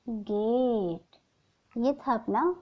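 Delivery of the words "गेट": गेट said very slowly and drawn out; teacher modeling गेट for students; model pronunciation. Shown as a demonstration. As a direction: stretch out गेट as the answer word. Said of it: "क्या बनेगा इसका".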